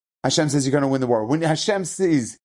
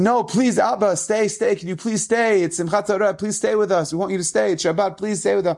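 put-on begging child; 6 semitones higher than usual. Children beg their leaving father on Simchat Torah, high begging. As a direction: plead like a small child who won't let go.

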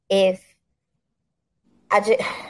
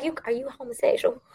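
higher, hushed query; mock discreet question; 5 semitones higher, 6 dB quieter than usual. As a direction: ask it softly, as if prying delicately. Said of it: "I'm gonna be like".

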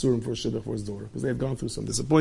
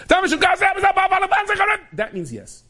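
very slow, much higher shouted taxi driver gibberish; Israeli taxi driver shout; much slower than usual. Retold or imitated. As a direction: belt it out like a brash Tel Aviv cabbie.

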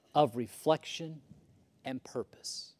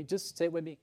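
ordinary talk

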